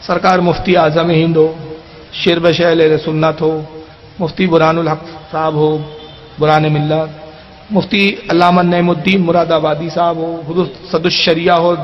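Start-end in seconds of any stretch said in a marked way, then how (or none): none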